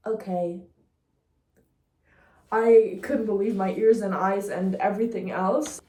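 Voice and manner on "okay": okay with no rise at the end; mocking German dub. Mocking the German version of the trailer, level imitation. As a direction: lightly imitate a lifeless dubbed line, keeping it level. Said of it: "The German version was just like".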